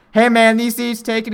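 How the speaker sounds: mock loud moviegoer asking; calling out casually. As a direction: imitate a guy hailing strangers for seats. Retold question called to strangers.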